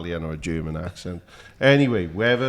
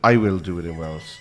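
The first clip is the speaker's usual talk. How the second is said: usual speaking voice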